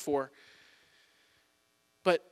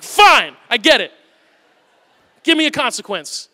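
put-on higher, loud, exasperated little boy giving in; huffy kid; 6 semitones higher, 12 dB louder than usual. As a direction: stomp off, grumbling and defiant. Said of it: "And he'll get mad".

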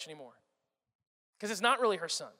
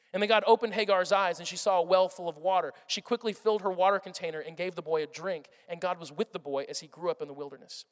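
ordinary delivery

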